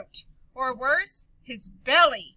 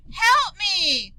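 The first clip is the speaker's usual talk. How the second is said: urgent cry for help, higher and louder; 5 semitones higher, 7 dB louder than usual. Acted. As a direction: call out for help in a panic. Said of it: "Maria cried".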